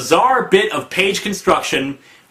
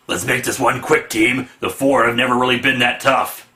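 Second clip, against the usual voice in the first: comic hero; 4 semitones lower than usual. Acted. Reading comic dialogue captions aloud.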